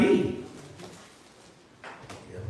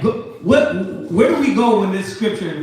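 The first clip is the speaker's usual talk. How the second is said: normal talking voice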